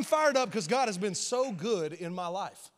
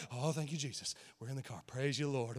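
relieved murmur; 7 semitones lower, 9 dB quieter than usual. Retold or imitated. Relief after reaching car.

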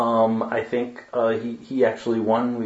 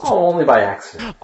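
playful tease; 8 semitones higher, 5 dB louder than usual. Real, not acted. Playful teasing reply.

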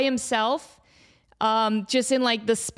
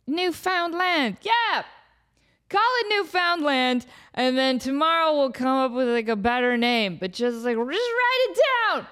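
put-on higher, slow, sloppy drunk explorer voice; 4 semitones higher, slower than usual. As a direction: drawl it out like a tipsy sailor. Drunk explorers naming land.

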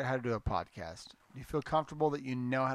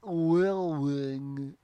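put-on very slow, muddled Russian accent; garbled Russian accent; much slower than usual. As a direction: drag the word out in mock Russian. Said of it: "What was that last word?".

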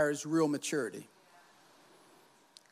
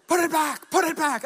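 high, loud panicked yell; teacher yelling; 9 semitones higher, 4 dB louder than usual. Retold or imitated. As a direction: shout it like a startled teacher. Teacher screaming at machete.

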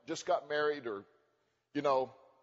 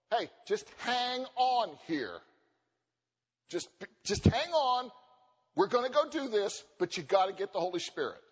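patient restraint; 3 semitones higher than usual. Retold or imitated. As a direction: stretch it out, calming them. Jesus voiced, slow.

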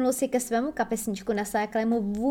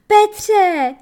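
high, very loud cry; calling out; 10 semitones higher, 11 dB louder than usual. A narrator's reading. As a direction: call for Peter across the ship. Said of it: "Její zvolání". (hlasité volání o pomoc)

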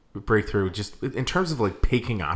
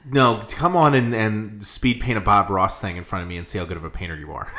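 ordinary talk

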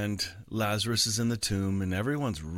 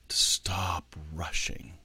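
slow, breathy whisper; slowed whispered reminder; 4 semitones lower, slower than usual. Real, not acted. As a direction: ease off into a hush. Slowing down the soul.

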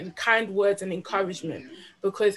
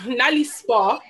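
ordinary talk, nothing marked

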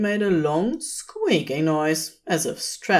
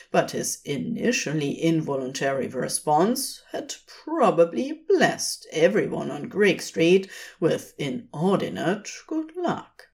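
usual speaking voice

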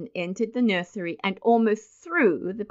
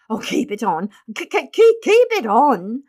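flustered hurry; 5 semitones higher, 7 dB louder than usual. A narrator's reading. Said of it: "said Mister Button hurriedly".

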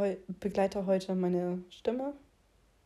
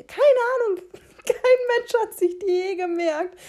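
hoch und laut lachend; 12 semitones higher, 9 dB louder than usual. Real, not acted. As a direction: lachend herausplatzen. (lachend und laut)

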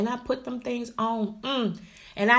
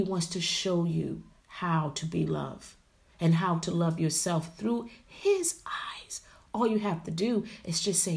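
tender whisper; 3 semitones lower, 5 dB quieter than usual. Real, not acted. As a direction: hush it like a confession. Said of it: "whispered invitation".

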